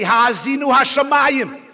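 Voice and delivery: "Ha'azinu hashamayim" called out loud and lifted; speaking up. Shown as a demonstration. Preacher showing how to address the heaven in youth, loud and lifted. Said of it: "Speak up to them".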